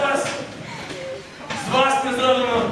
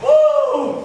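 high whoop; 5 dB louder than usual. Acted. Showman's entrance cry.

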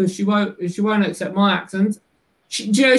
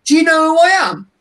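high, loud, fast gangster swagger; mock gangster boast; 10 semitones higher, 6 dB louder, faster than usual. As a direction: puff up like a London hardman. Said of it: "be like, the Cray Twins".

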